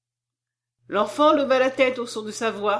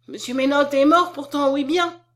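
high, loud alarmed protest; insist, as if facing a ghost; raised voice of frightened disbelief; superstitious dread; 5 semitones higher, 7 dB louder than usual. A narrator's reading. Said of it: "avec un superstitieux effroi".

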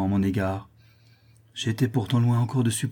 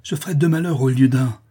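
raised, struggling anger; heated, higher and louder; furious threat; 4 semitones higher, 5 dB louder than usual. Acted. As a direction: threaten while fighting to get free. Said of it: "s'écria l'artisan furieux en se débattant".

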